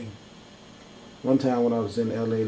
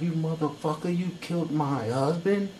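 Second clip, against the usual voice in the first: grieving widow's rage; 6 semitones higher than usual. Pretend. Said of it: "She's looking at him like".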